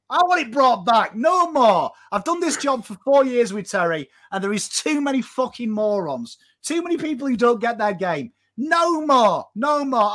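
heated rant; fired-up rant. Real, not acted. Fan rant building to shouting.

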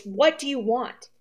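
soft, slow frightened question; terrified. A narrator's reading. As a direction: ask it quietly, scared stiff. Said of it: "She asked in a terrified voice".

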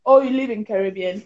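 normal talking voice

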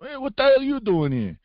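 high, indignant Italian-American greeting; Italian-American accent. Acted. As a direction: challenge them like a Bronx Italian. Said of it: "Can't do an Italian accent".

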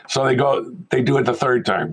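ordinary talk, nothing marked

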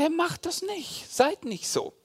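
high, soft appeal; high-pitched, drawn-out gentle urging; imploring Jesus. Acted. Acts Jesus appealing to listeners.

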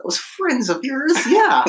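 put-on high, slow old lady voice; grandma voice. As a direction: answer as the fussy grandma. Voicing grandma back.

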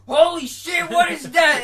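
high, loud yell of fright; terrified shout. Retold or imitated. The brain's dream scene, shouting in terror. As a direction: scream it like you've seen a monster. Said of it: "you wake up and you're terrified".

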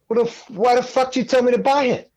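high-pitched mock outrage; exasperated protest. Student to mentor who recommended the grinder, exasperated high protest.

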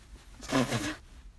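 short deep chuckle; low laugh. Real, not acted. Vlogger laughing at her own scolding.

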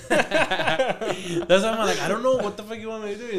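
loud, high, laughing disbelief; laughing exasperation. Real, not acted. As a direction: laugh through the helpless question.

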